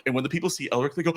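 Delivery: ordinary talk, nothing marked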